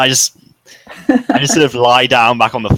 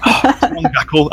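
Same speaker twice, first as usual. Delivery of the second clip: much higher, through a laugh; character teasing Jackal while dressing; laughing protest; 6 semitones higher than usual. Acted. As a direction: protest with a giggle. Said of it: "I'm like".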